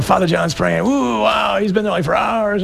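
a mock-amazed layman, lightly voiced; gushing parishioner. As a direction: play an impressed churchgoer in mock wonder. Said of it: "you look at us and go".

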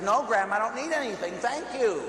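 polite but insistent protest; insistent refusal. Retold or imitated. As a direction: refuse firmly, pitch climbing to the final thank you. Refuses pushed money more insistently.

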